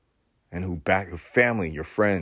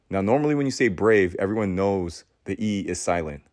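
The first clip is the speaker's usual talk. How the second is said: ordinary talk, nothing marked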